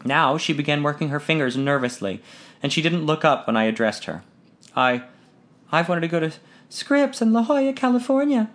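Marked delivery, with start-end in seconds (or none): 4.7-8.5 put-on high, hesitant young woman's voice; a timid girl finally daring to hope; shy daughter. A narrator's reading. Shy daughter telling her father her dream, high and hesitant.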